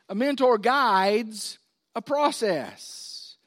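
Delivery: slow, weighted, every word pressed; slow deliberate teaching restatement; slow emphasis. Shown as a demonstration. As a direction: lay it down word by word. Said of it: "repeated slowly for emphasis".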